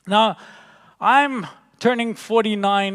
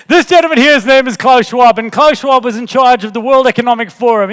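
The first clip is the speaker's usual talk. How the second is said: energetic announcing; 4 semitones higher, 9 dB louder than usual. Real, not acted. Redoing the intro, laughing.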